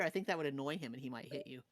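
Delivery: ordinary talk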